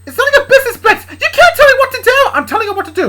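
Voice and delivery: high, loud, screaming retort; defiant shriek. Acted. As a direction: scream back at your father. Luna argues back at her father.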